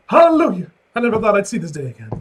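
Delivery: high, loud hallelujah, then rushed; joyous cry. Real, not acted. Cheering loudly at the page, rejoicing over the coffee. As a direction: shout it like a church revival.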